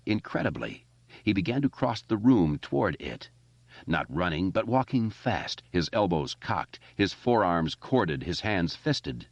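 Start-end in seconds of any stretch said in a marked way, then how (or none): none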